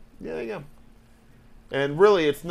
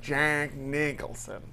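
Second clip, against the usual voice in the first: slow, hushed celebrity drawl; lazy movie-star impression; Jack Nicholson; 2 semitones lower, 9 dB quieter, much slower than usual. Shown as a demonstration. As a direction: draw out each syllable, softly. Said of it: "who was I doing an impression of".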